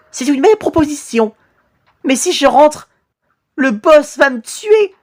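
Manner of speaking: high-pitched worried haggling; nervous victim. Acted. Victim afraid the boss will kill him, high nervous voice.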